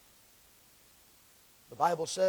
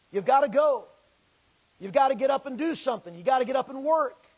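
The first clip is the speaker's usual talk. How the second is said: louder, higher, pushing to go; full-force preaching; 5 semitones higher, 6 dB louder, faster than usual. Real, not acted. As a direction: drive them to get up.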